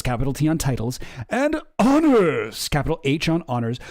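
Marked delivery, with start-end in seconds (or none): 1.3-2.7 high, slow pompous read; mock royal announcement. Reading the palace statement aloud, pompous mock herald. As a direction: stretch it out like a stuffy herald.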